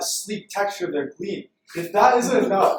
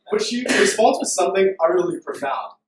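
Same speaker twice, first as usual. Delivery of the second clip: ordinary talk, nothing marked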